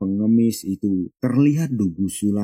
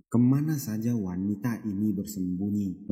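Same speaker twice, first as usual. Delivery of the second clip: much softer, spoken aside to himself; mutter; 9 dB quieter than usual. A narrator's reading. Father wondering where his daughter hid, muttered softly. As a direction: grumble it under your breath. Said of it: "Lalu dia bergumam".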